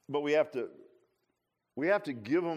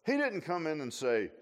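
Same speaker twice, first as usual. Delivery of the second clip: usual speaking voice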